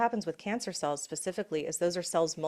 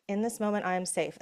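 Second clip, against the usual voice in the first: calm affirmation. Shown as a demonstration. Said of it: "I get to go".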